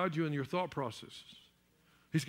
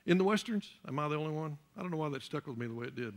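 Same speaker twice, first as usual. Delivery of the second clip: normal talking voice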